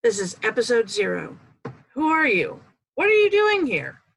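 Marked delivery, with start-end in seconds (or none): 1.9-4.0 high, loud, demanding challenge; dramatic questions. Acted. Host confronting the viewer with loud, high questions. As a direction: call out to a stranger loudly.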